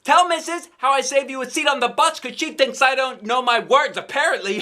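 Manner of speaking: high, loud call across the room; shouting. Acted. Girl calling friend across classroom, high loud shout. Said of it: "I shouted across the room".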